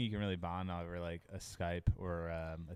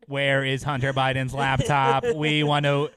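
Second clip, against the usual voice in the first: loud, slow, higher alien demand; 5 semitones higher, 12 dB louder, slower than usual. Acted. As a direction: boom it out slowly like an invader. Alien demands Biden's laptop.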